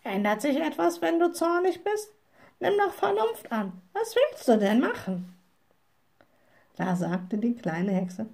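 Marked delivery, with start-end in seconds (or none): 0.0-5.3 high-pitched admonishing raven; lightly voiced, pitched-up raven; croaking raven. A narrator's reading. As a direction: reason with the witch as a bird. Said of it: "krächzte der Rabe".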